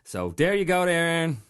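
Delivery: Newfoundland accent, lifted opening; Newfie accent. Shown as a demonstration. Voice actor wrapping up the listener's request, Newfie accent. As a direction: sign off like an outport Newfoundlander. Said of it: "do a Newfie accent".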